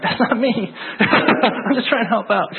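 high, laughing denial; laughing through a flustered denial; laughing protest. Retold or imitated. Laughing at being suspected.

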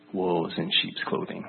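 slow, voiceless ominous whisper; a staff member's hushed, ominous warning. Retold or imitated. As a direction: breathe it out like a dark secret. Staff guy's ominous retold warning.

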